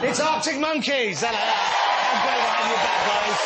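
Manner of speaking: high, loud, slow band intro; host welcoming the band back; band introduction. Acted. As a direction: announce the band like a showman.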